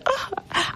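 high, breathy squeal of excitement; a short excited yelp; hyped-up squeal. Real, not acted. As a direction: squeal at your own hype. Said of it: "I hyped myself up".

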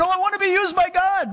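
high, gushing mock eagerness; high-pitched naive enthusiasm; eager believer. Acting an eager would-be servant of God.

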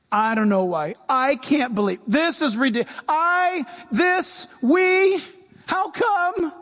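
preacher imitating a believer complaining; whining complaint. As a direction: complain like nothing is fair. Acting out a complaining person.

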